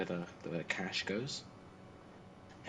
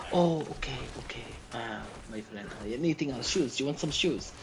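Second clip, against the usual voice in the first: high, coaxing upsell; pushy vendor; 6 semitones higher, 4 dB louder than usual. Retold or imitated. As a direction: switch at once to selling shoes. Seller pushes shoes instead.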